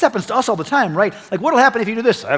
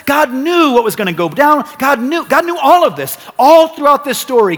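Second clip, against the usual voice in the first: louder, higher preaching build; rising sermon; 7 semitones higher, 7 dB louder than usual. Real, not acted. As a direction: drive it home to the congregation. Preaching at full force.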